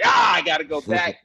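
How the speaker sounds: loud groaned 'ah' of annoyance; exasperated. Acted. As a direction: groan it out loud, having missed the exit. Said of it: "like when you miss your exit".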